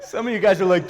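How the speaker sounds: usual speaking voice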